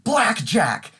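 imitated abrupt high-pitched outburst; sudden exclamation. Roommate ending a phone call before leaving, sudden outburst. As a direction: blurt it out high and loud, as the punchline. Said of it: "then just said".

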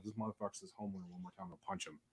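hushed, fast inner-voice whisper; inner thought. Retold or imitated. Thinking to herself about the resident, whispered. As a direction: mutter it under your breath like a secret thought. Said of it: "I kept thinking to myself".

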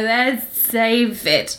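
put-on slow, slurred drunk woman; drunken slur. Wasted woman asking after her lover, slurring slowly. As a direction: drag the words out tipsily. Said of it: "She's fucking wasted".

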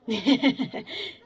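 short acted giggle; light chuckle, a bit loud; amused giggle. A narrator's reading. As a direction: giggle before answering. Girl amused by child's impatience.

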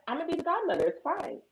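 high, sweet mock reassurance; sugary taunt. Sister taunting the pregnant caller, high and sugary. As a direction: reassure her sweetly and cruelly. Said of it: "I be like".

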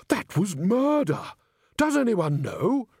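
high, slow, shocked voice; alarmed. Acted. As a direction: recoil in alarm. Ghost alarmed at his murder.

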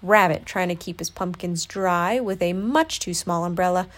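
ordinary delivery

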